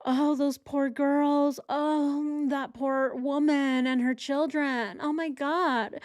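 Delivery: hammed-up fake sorrow; mock sympathy. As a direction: sneer at fake pity. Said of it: "what she did".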